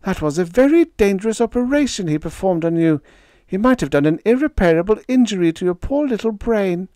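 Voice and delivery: distressed squall. A narrator's reading. Panda squalling to Freddie about the dangerous operation. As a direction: rush it out in distress. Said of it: "she squalled".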